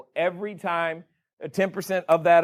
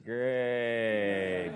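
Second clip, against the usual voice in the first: flat, low, soft deadpan "great"; a mock-deflated sigh of a word; sarcastic great; 7 semitones lower, 8 dB quieter than usual. Students hearing the interest rate, sarcastic and flat. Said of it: "How many of you are like".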